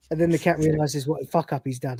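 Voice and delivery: ordinary talk, nothing marked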